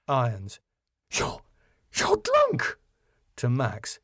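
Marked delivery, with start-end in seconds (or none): 1.1-2.8 halting, then very high outrage; stammer, then shriek the accusation; scandalised high-pitched disbelief; aghast. Acted. Irons finds her son drunk.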